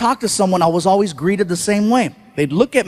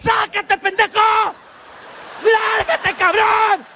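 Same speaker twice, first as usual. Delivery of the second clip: much higher, shouted Spanish cursing; road rage; 11 semitones higher than usual. Retold or imitated. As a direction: scream at the driver who cut you off. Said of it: "you cut off a Mexican in traffic".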